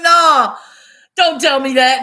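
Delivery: loud mock wail of protest; loud dismayed no, hammed-up; loud protest at bad news about the mint. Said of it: "And I was like".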